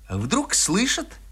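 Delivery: ordinary delivery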